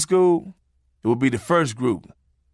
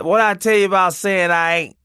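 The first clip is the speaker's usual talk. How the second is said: mock angry scolding; 6 semitones higher, 4 dB louder than usual. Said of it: "The woman got mad and said".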